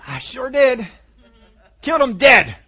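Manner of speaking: high, loud, punched-out bragging; triumphant boast. Acted. David answers if he killed it too, triumphant boast. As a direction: crow about it at full voice.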